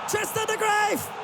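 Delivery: high excited goal call; goal celebration shout. Real, not acted. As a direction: yell it as the ball hits the net. Late equaliser goes in.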